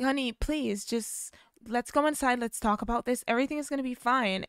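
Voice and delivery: high, quick coaxing mother; coaxing mom. Retold or imitated. Mom coaxing her son inside, high and fast. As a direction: soothe him quickly.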